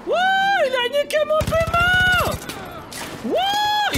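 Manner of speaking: very high, loud yell; furious yelling. Real, not acted. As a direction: yell out in rage. Yelling during chaotic fight.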